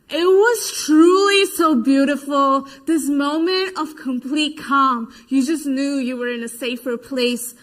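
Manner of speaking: ordinary delivery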